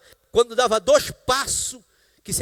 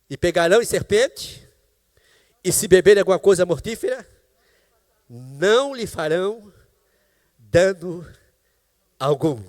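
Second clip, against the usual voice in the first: slow, weighty reading of the verse; scripture reading; 3 semitones lower, 6 dB louder than usual. Acted. Preacher reading scripture to congregation, slow and solemn. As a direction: read the verse slowly and solemnly.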